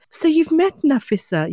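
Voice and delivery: polite aunt. Retold or imitated. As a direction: imitate an aunt making a polite introduction. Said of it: "my aunt very politely said".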